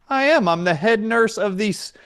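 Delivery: high-pitched retold answer; lifted imitation of a nurse; imitating the nurse.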